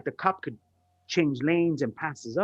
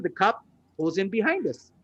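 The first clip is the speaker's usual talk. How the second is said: ordinary talk, nothing marked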